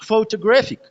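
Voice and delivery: Photographic with टो and फिक left weak, ग्रा lifted high; unstressed syllable demo. Shown as a demonstration. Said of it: "ये unstress syllables होते हैं". (Photographic में टो और फिक बिना ज़ोर, ग्रा ऊँचा)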